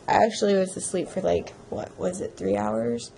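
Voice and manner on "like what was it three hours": normal talking voice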